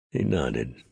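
ordinary delivery, nothing marked

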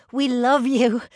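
loud, high adoration; gushing fan. A narrator's reading. Brianna declaring love to the twins, gushing. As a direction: call it out like a thrilled fan.

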